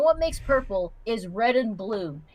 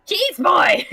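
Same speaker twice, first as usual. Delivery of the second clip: gleeful; 6 semitones higher, 8 dB louder than usual. Real, not acted. Repeating chat joke loudly.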